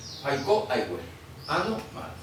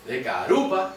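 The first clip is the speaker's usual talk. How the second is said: "De carupa" with "ru" stressed and higher, "pa" unstressed; 5 semitones higher than usual. Shown as a demonstration. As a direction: show the accent on "ru" in "De carupa". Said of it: "¿dónde cae el acento?".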